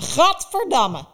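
very loud, slow, high cry of disgust; disgusted outburst. Acted. Baba Yaga recoils loudly at the doll story, disgusted. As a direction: spit it out in loathing.

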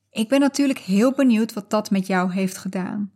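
ordinary delivery

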